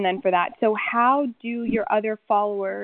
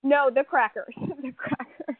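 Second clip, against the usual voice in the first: laughing; 4 semitones higher, 4 dB quieter than usual. Real, not acted. Laughing at goldfish mix-up.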